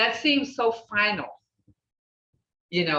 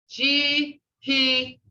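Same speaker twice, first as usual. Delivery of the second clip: broken into syllables; 4 semitones higher than usual. Shown as a demonstration. Teacher showing the class "Shihijagathis", broken up syllable by syllable. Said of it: "see how I broke up those two syllable words".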